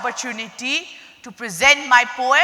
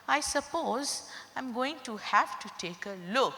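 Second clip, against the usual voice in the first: much softer muttering dentist; muttered; 19 dB quieter than usual. Acted. Nervous dentist grudgingly agrees to look, softly muttered. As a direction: mutter it under your breath, reluctant. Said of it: "He muttered".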